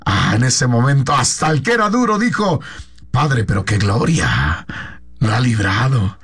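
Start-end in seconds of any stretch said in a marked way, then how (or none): 3.1-6.2 much lower, gruff amazed king voice; a tough king stunned by glory; hard king amazed. Acted. Hard king's amazed exclamation. (el rey duro, asombrado, con voz grave)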